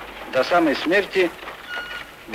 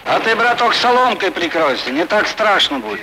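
loud; 8 semitones higher, 5 dB louder than usual. Acted. Joking during air raid.

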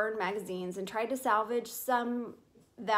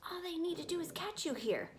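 much higher and softer, a hissed aside; under his breath; 8 semitones higher, 5 dB quieter, faster than usual. Acted. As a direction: scold quietly so nobody hears. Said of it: "said Chester under his breath".

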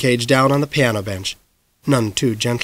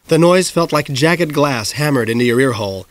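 usual speaking voice

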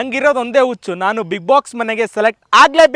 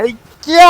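high, loud exclamation; loud yell; 4 semitones higher, 11 dB louder, much slower than usual. Acted. Vlogger starting his backwards journey, loud yell. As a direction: let out a sudden high yell.

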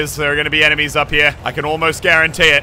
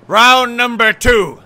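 announcer voice; 7 semitones higher, 6 dB louder, much slower than usual. Real, not acted. Announcing new round to lads.

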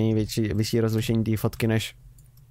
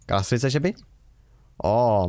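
ordinary talk

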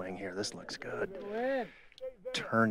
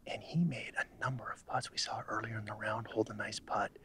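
golf commentator; 6 dB quieter than usual. Acted.

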